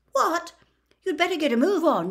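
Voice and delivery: high, loud, fast disbelief; a captain aghast his Seeker has no broom; startled outburst. Acted. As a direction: blurt it out in alarm. Wood shocked at no new broom.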